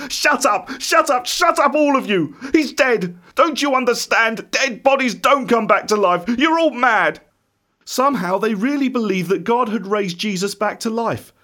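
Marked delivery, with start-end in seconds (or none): 0.1-7.2 high-pitched, exasperated anger; furious outburst. A narrator's reading. Thomas silencing the disciples, furious. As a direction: cut them all off in a raised, frantic voice.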